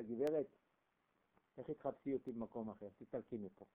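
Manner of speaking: a hint of a spiteful rival, voiced down low; mocking rival wife.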